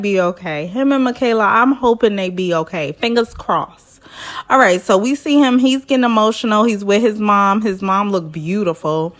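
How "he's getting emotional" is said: ordinary talk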